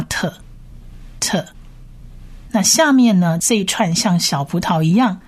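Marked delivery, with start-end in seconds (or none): 0.0-1.5 特 as an aspirated "t" sound; tav sound. Shown as a demonstration. Teacher giving learners the sound of tav, isolated 特. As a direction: sound out 特 by itself, pausing between repeats. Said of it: "其实就是直接发特".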